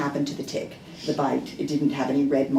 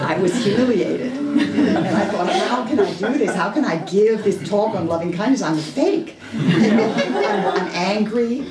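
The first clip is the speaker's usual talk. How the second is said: laughing; 6 semitones higher, 6 dB louder than usual. Real, not acted. Laughing at her own anger.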